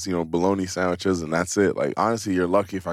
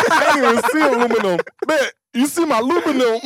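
much higher, louder mock voice; high joking riff; 5 dB louder than usual. Riffing with friends on the new word, high mock voice. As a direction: squeal it out as a silly bit.

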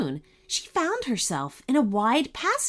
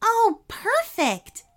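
happy; 14 semitones higher, much slower than usual. Acted. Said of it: "Ananya said happily".

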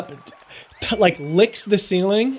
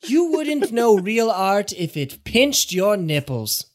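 high, sneering critic voice; pompous art critic scoffing; snobby critic; 4 semitones higher, slower than usual. Acted. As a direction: look down your nose and jeer.

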